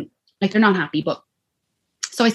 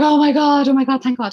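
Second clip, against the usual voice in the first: high, loud gush of relief; overwhelmed relief; 8 semitones higher, 4 dB louder than usual. Retold or imitated. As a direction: cry out in relief, reliving the moment. Retold relief after feeling baby flicker.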